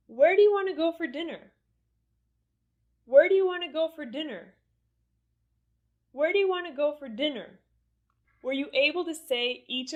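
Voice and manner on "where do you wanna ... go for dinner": high, quick question falling on "dinner"; falling intonation. Shown as a demonstration. Teacher repeating for learners to say along, falling intonation on "dinner". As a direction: start high and bring "dinner" down. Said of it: "with the rising or falling intonation".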